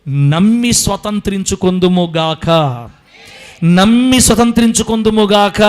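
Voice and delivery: loud, slow proclamation over music; loud sermon blessing; preaching at full force. Acted. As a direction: declare it to the church with rising power.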